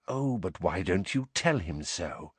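quiet, insinuating murmur; whispered taunt. A narrator's reading. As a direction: taunt him under your breath. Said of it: "whispered Snape".

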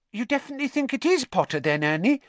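put-on high, anxious question from a girl; anxious schoolgirl voice. A narrator's reading. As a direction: ask it nervously, worried about the answer.

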